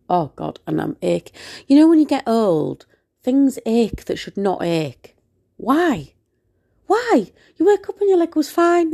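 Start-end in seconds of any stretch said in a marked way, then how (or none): 5.6-8.9 playfully exasperated, high-pitched complaint; comic exasperation. Real, not acted. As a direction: wail in playful bewilderment. Comic complaint about aching.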